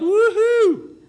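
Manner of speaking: loud, high whoop of joy; jubilant yell. Acted. Overjoyed workers at a whole day's wages, jubilant yell. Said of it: "jumped up and yelled".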